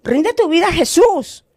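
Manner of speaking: acted earnest entreaty, pitched high; insistent pleading. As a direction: implore them with rising urgency, voicing a retold appeal. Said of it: "insistía y le decía".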